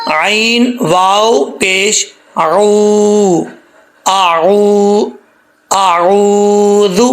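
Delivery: اعوذ with the waw drawn out one alif long; long ayn-waw. Shown as a demonstration. Teacher showing students how to lengthen the waw in اعوذ. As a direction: stretch the عو in اعوذ slowly. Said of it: "لمبا کریں گے".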